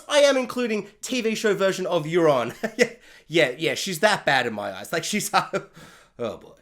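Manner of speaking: usual speaking voice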